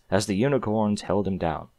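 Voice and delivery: ordinary delivery, nothing marked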